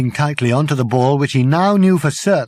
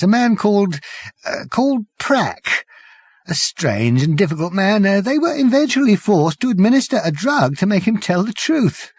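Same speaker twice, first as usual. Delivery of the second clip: higher, frightened whimpering voice; a broken man whimpering out his story; tiny whimper; 6 semitones higher than usual. Acted. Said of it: "he said in a tiny whimper".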